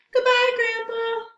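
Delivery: high, loud character goodbye; little penguin voice. Acted. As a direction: call back cheerfully to Grandpa.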